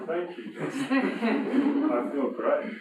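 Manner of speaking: mock gratitude while giggling; laughing sarcasm. Real, not acted. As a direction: ironic thanks through laughter. Sarcastic thanks after depressing story.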